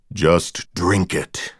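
low, very slow, suggestive murmur; deep wicked voice. A narrator's reading. Sinister voice over the club music, low slow suggestive. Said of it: "deep electronically distorted voice would whisper something".

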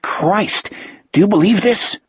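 a cop inside the box, stunned; muffled cop voice. A narrator's reading.

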